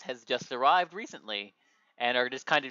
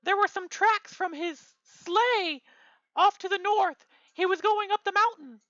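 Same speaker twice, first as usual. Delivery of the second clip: high squeaky elf voice; squeaky little elf helper. Acted.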